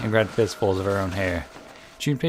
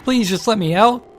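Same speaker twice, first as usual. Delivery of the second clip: high, much louder begging screams; frantic screaming; 13 semitones higher, 9 dB louder than usual. Acted. June pounding the door, frantic begging screams. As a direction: scream and beg as if nobody hears. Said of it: "pulling the screaming girl away from the door".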